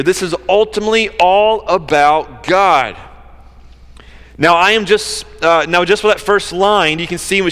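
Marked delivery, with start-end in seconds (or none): none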